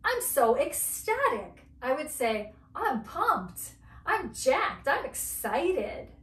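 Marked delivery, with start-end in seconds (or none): 0.0-1.7 higher, louder mock-ecstatic line; acted excitement. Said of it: "if I was really excited". 2.7-6.1 pumped-up, high-pitched burst; acted excitement. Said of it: "if I was really excited".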